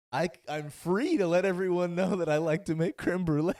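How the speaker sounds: mock earnest baker. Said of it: "he was like".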